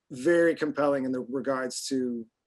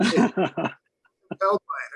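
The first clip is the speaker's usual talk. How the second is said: very slow, higher, louder mock-solemn line; slow emphatic joke; 6 semitones higher, 6 dB louder, much slower than usual. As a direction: draw it out as if hypnotized. Jokes about accents.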